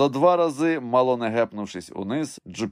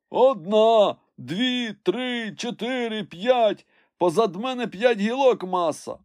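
high counting call; servant calling down; 13 semitones higher, 3 dB louder, slower than usual. A narrator's reading. Climber counts branches for his master, high far-off call. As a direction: count aloud for someone far below.

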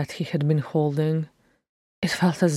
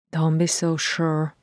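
ordinary delivery, nothing marked

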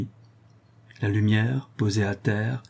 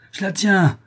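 high, loud exultant cry; raised voice, jumping up in pitch; triumphant cry; 10 semitones higher, 5 dB louder than usual. A narrator's reading. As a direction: cry out your catch. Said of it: "cria le maître d'école du fond du caveau".

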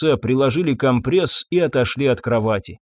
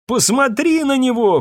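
high-pitched distraught cry; screaming; 11 semitones higher than usual. A narrator's reading. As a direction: cry out in anguish, voice pitched right up. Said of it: "кричала Ирен".

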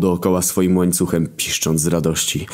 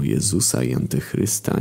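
usual speaking voice

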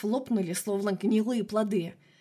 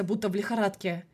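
ordinary delivery, nothing marked

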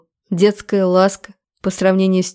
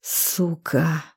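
much softer, low-key growl under control; growled curse; 2 semitones lower, 9 dB quieter than usual. A narrator's reading. Man steps back to keep from choking her, growled curse. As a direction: growl it quietly, holding back rage. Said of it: "прорычал, отступая на шаг".

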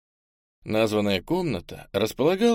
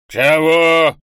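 loud angry bellow; roar; 6 semitones higher, 12 dB louder than usual. A narrator's reading. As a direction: roar it out in fury. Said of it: "заревел лежавший мужчина". (рёв)

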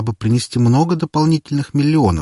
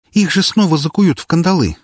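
snorting; 9 semitones higher, 4 dB louder than usual. Acted. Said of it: "фыркнула Валькирия".

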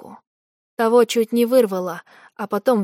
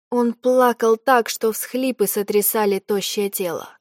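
ordinary delivery, nothing marked

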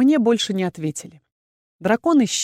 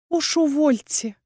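slow, higher, wincing distaste; wincing refusal; 7 semitones higher, slower than usual. A narrator's reading. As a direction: pull a face and refuse it. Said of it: "поморщилась я".

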